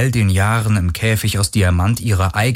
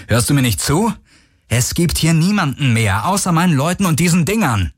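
raised-pitch, urgent insistence; exasperated; 9 semitones higher than usual. A narrator's reading. Parker arguing that nobody else is left, exasperated. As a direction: hammer it home, higher and exasperated. Parker's outburst at Garland continues.

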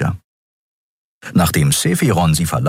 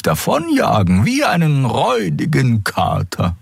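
higher, slow knowing reassurance; amused Xea; 6 semitones higher than usual. A narrator's reading. Xea reassuring Keru about Endriel, knowing and amused.

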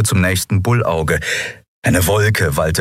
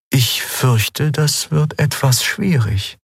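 lightly voiced spirit figure, slow and measured; Eidolon, bedächtig; 7 semitones higher, slower than usual. A narrator's reading. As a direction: break it to her slowly and calmly. (bedächtige Stimme eines Geistwesens)

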